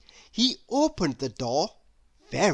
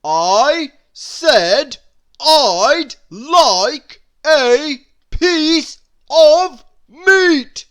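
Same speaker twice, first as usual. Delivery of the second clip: very loud, high, slow roar; 9 semitones higher, 12 dB louder, much slower than usual. A narrator's reading. Mister Noisy roaring at the baker, very slow. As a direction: roar every word, drawn out. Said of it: "roared Mister Noisy".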